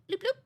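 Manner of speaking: high little phone-notification blips; imitated phone chime. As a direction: mimic a text alert, high-pitched. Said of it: "every single time my phone would".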